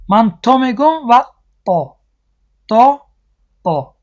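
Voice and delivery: teacher demos the two ta letters; two kinds of ta. Shown as a demonstration. Teacher contrasts two ta letters for students.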